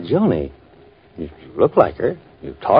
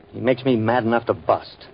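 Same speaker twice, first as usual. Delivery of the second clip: ordinary talk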